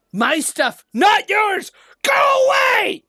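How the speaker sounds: possessive gnome ghost, building to a high scream; ear-splitting shout. Acted. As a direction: start contained, then erupt into a piercing yell. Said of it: "Sorry if I hurt your ears".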